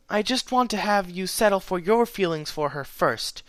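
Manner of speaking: gentle pony voice; gentle young mare. A narrator's reading. Twilight's voice in story.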